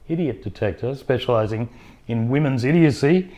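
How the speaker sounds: normal talking voice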